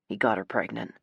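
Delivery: low, soft male delivery. A narrator's reading. Nate breaks pregnancy news to sister, low voice. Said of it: "His voice is low".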